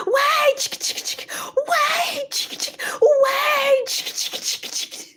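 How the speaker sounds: high shouted wait, breathy chick chick; calling after the bus. Retold or imitated. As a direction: call out high, then hiss the chicks. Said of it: "I was like".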